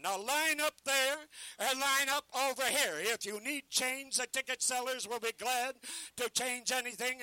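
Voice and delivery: loud ballyhoo, selling hard to a crowd; carnival barker pitch. Acted. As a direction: bark the show to passers-by.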